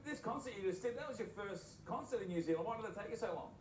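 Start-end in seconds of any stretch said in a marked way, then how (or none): none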